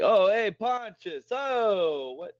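a hammed-up Harvey Keitel New York accent, high and amazed; imitating Harvey Keitel's accent. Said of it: "with his accent, he was like".

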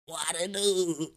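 slow, laughing mimicry of his friend; a lightly voiced copy, drawn out; Peter impression. Mimicking his co-host's catchphrase, slow and laughing. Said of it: "Should I actually try and do your voice?".

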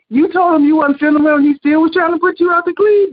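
high, outraged disbelief; incredulous. Retold or imitated. Friend reacting to housekeeper pushing her out, incredulous. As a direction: replay your disbelief on the friend's behalf.

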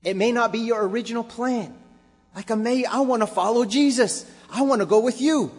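preaching at full force. Acted. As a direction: preach it with fire and climb.

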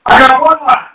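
preacher insisting to his wife, loud; emphatic. Real, not acted. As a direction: drive it home to the congregation. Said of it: "I thought she said something".

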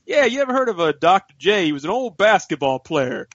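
caricatured dad, high then settling; dad impression. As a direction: imitate dad explaining, oblivious. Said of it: "He's like".

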